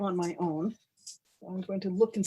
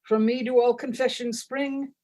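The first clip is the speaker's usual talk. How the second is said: grand goddess proclamation, louder than chat; mock-solemn ritual reading; 3 semitones higher, 7 dB louder than usual. Chocolate goddess addressing her hungry followers, mock-solemn proclamation. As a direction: declaim it like a tongue-in-cheek scripture.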